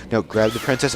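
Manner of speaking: normal talking voice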